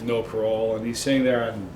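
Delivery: normal talking voice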